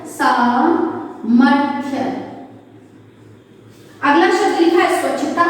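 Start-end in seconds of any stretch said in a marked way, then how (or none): 0.1-2.0 very slow, drawn-out word for dictation. Shown as a demonstration. Teacher dictating the answer word, very slowly. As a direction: stretch each syllable for the children to write. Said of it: "क्या होता है?".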